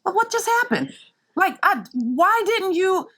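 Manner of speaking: high, baffled demand; exasperated disbelief. Retold or imitated. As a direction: confront the team leader in shock. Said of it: "and you're like".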